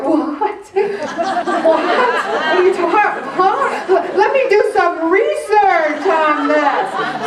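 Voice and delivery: high, loud laughing what; laughing disbelief. Retold or imitated. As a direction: laugh through mock shock. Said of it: "I'm like".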